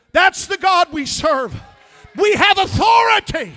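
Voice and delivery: loud high triumphant proclamation; shouted declaration. Acted. Preacher proclaiming authority to the congregation, shouting.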